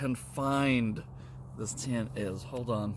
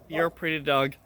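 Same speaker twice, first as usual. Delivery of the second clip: ordinary delivery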